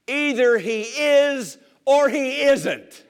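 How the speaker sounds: slow, high and loud, daring them to doubt; emphatic challenge. Real, not acted. Preacher challenging the congregation, slow and loud. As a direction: lay it down slowly and forcefully.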